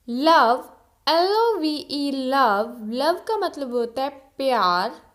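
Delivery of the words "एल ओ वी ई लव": ordinary delivery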